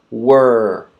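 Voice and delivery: Were said alone. Shown as a demonstration. Teacher modeling the start of world, clear. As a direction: say Were with a curled R. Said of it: "start at the beginning sound".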